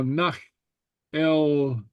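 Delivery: ordinary talk, nothing marked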